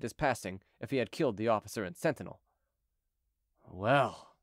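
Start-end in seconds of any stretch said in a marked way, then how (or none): 3.6-4.3 low, resigned sigh of a word. A narrator's reading. Chicot beaten at the gate, sighing to himself. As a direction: sigh it to yourself, pitched low. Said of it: "said Chicot to himself with a sigh".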